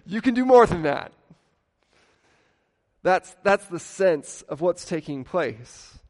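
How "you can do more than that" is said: higher, louder, exasperated parent; a parent scolding a child who wants to waste his life. Retold or imitated. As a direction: shake some sense into your child. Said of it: "grab them and shake them and go".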